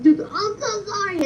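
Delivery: high, slow whiny fake sob; mock sobbing apology. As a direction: whimper it like a crying cheater. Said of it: "doing his big one".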